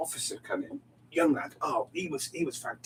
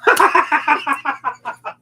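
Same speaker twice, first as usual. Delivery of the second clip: higher and louder, breaking into laughter; laughing burst; 10 semitones higher, 9 dB louder, much slower than usual. Real, not acted. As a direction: laugh out the word in surprise. Laughing at surprising fact.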